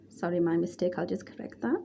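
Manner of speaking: normal talking voice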